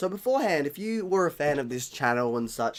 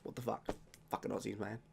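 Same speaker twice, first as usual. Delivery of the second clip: low, soft mock dismay; muttered disbelief; 3 semitones lower, 13 dB quieter than usual. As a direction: grumble it under your breath like an appalled ancestor. Forefathers' imagined dismayed reaction.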